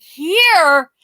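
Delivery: high, loud echo; disbelieving. Retold or imitated. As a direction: throw it back in disbelief. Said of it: "I'm like".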